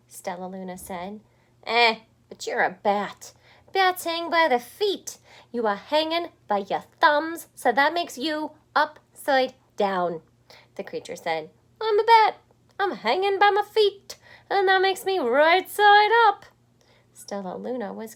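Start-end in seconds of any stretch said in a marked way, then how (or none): 1.6-10.2 loud, insistent creature voice; loud know-it-all bat. Acted. Other bat lectures Stellaluna. 11.8-16.4 high, loud insistent bat; loud squeaky bat. Acted.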